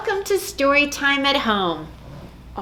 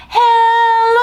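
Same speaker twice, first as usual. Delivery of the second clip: a big high shout, much louder; loudest hello; 12 semitones higher, 9 dB louder than usual. Shown as a demonstration. Librarian shows kids the loudest hello, shouting. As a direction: call it out as loud as you can. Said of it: "as loud as we can".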